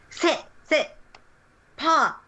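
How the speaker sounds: high-pitched little commands to a dog. Acted. Game master voices delighted kids ordering the dog, high-pitched. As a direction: call out tricks in a high voice.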